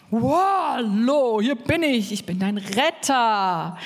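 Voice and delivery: hammed-up heroic arrival, drawn out at the end; pompous rescuer. Preacher enters as the rescuer, grandly theatrical. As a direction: announce yourself like a grand saviour. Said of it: "Und dann kommt der Retter".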